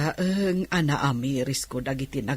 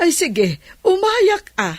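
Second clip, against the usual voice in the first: excited; 12 semitones higher, 4 dB louder than usual. Acted. Eagerly accepting the invite.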